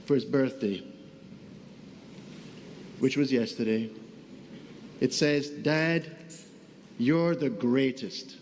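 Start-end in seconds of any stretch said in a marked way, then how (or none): none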